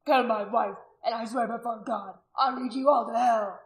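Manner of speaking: loud, emotional vow; anguished, loud warning; furious threat. A narrator's reading. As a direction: swear it at them with force. Husband threatening the crowd.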